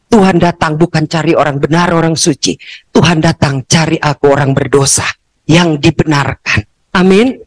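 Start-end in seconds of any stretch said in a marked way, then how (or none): none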